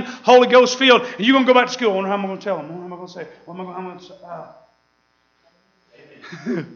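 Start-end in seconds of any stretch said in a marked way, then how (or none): 1.9-6.6 much softer, trailing, unsure; nervous muttering. Retold or imitated. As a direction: fumble it as if you can't find the words. New convert fretting about telling.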